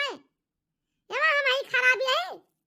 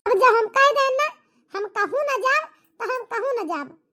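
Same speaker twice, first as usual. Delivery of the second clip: loud, stubborn outburst; angry insistence; 6 dB louder than usual. Acted. Wife refusing husband until he apologises, loud and angry. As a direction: put your foot down hard.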